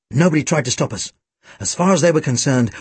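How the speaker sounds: usual speaking voice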